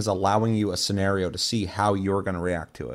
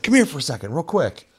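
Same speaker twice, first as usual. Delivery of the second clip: higher, louder imitated beckoning; roadside stranger; 7 semitones higher, 4 dB louder than usual. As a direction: call someone over urgently.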